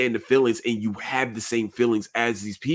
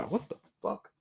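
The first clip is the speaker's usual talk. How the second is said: startled; 7 semitones higher, 11 dB quieter than usual. Real, not acted. Startled by noise in room.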